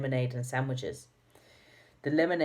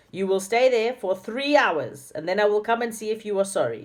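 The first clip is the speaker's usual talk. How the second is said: higher, louder stern sentence; snapped; 7 semitones higher, 5 dB louder than usual. A narrator's reading. Dame Snap locking the children in the room, snapping. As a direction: lay down the punishment sharply. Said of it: "she snapped".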